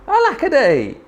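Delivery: high woeful lament; crying out. A narrator's reading. Drip mourns being left behind, high woeful cry. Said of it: "And he cried".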